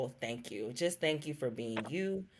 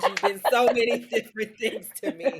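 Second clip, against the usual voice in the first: laughs through it, higher and louder; laughing; 7 semitones higher, 9 dB louder than usual. Real, not acted. Host praising her friend, laughing higher and louder. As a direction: bursts into a happy laugh mid-sentence.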